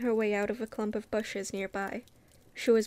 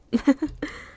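laugh; 5 semitones higher, 5 dB louder than usual. Real, not acted. Reader laughs at own stumble.

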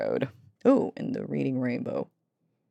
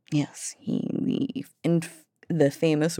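ordinary delivery

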